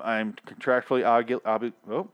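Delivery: normal talking voice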